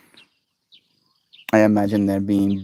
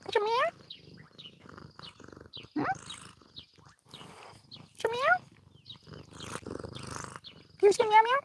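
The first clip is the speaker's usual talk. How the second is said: very high, soft coaxing voice to the cat; pet talk; 8 dB quieter, much faster than usual. Real, not acted. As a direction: gently coax the cat to meow.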